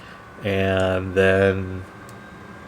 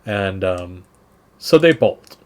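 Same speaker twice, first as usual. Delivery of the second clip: ordinary talk, nothing marked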